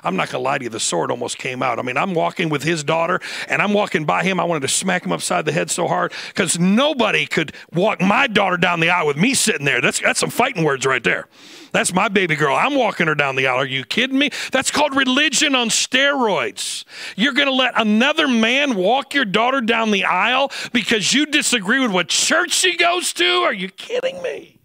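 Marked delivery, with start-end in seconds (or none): 1.8-23.2 loud, high indignant sermon; indignant preaching. Acted. Preacher outraged at the father, preaching hard. 23.2-24.6 very high, slow disbelief; incredulous cry. Real, not acted. Pastor to congregation, high incredulous cry.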